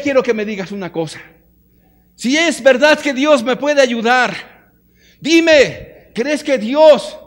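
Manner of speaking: loud, high, desperate demanding questions; grieving mother pressing a preacher, loud and high; pleading woman. Retold or imitated. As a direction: beg the preacher for an answer.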